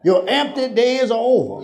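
high, ringing shouted decree; prophetic declaration. Acted. Preaching climax.

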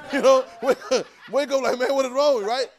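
loud, high, laughing delivery; worked up and cracking up. Acted. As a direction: laugh through a mock startled wake-up. Laughing through acted startled waking.

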